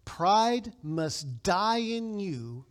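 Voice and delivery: slow, hushed, weighty reading; solemn quotation. Acted. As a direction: let every phrase sink in slowly. Reads Murray quote solemnly.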